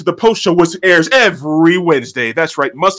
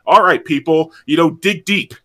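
ordinary talk

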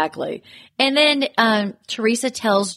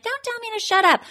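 pissed-off retort; 13 semitones higher than usual. Retold or imitated. Castmate imitated arguing back at Jennifer, indignant and high. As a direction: imitate an indignant comeback, pitched way up. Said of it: "Marge is pissed at Jennifer".

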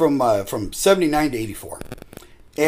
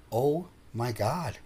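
stunned; 3 semitones lower, 10 dB quieter, slower than usual. Retold or imitated. Friends stunned by the speaker.

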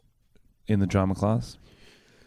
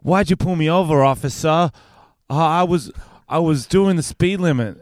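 lightly voiced higher driver pleading with an officer; driver in improv; 6 semitones higher, 4 dB louder than usual. Classmate talks to an officer in improv, imitated driver. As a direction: imitate a classmate playing a pulled-over driver. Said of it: "She goes".

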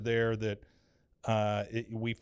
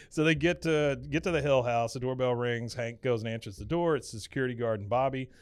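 ordinary talk, nothing marked

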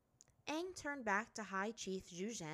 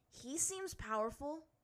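put-on young boy voice; 8 semitones higher, slower than usual. A narrator's reading. Aang voiced in story.

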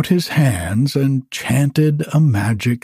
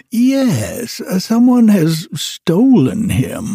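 put-on much higher, plaintive old wizard voice; fretful wizard; 8 semitones higher, slower than usual. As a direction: whine about the lost dog as the wizard.

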